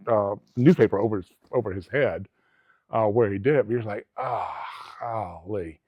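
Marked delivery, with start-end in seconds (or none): 4.2-5.8 long, low, drawn-out groan of dismay; mild dismay. Retold or imitated. Officer reacting to a grisly scene, mild dismay. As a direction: draw it out slowly and softly, mildly appalled.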